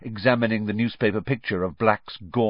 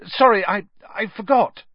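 faltering, high-pitched apology; hasty; 13 semitones higher, 8 dB louder than usual. A narrator's reading. Harry excusing himself to Stan, hasty and flustered. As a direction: stumble hastily through an excuse. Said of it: "said Harry hastily".

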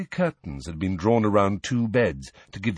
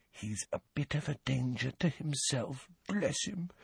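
hushed, much softer aside; confidential whisper; 5 semitones higher, 11 dB quieter than usual. A narrator's reading. Healer confiding about her patient to his visitors, hushed. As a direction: drop to a discreet near-whisper. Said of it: "she lowered her voice to a whisper".

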